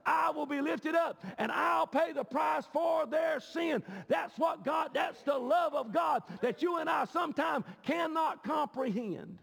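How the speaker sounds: loud, high-pitched, driving sermon delivery; full-force preaching. Real, not acted. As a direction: preach it hard, hitting the key words.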